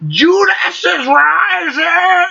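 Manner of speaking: high, slow screamed vocal; screaming metal singer. Retold or imitated. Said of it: "and I'm like".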